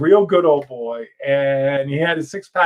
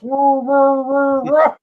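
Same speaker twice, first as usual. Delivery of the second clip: high yipping woo woo like baying hounds; excited hound-dog howls; mock dog howl; 13 semitones higher, 4 dB louder, much slower than usual. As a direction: howl it out like tracking dogs. Hearing the dogs chase convicts.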